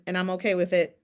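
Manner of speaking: ordinary delivery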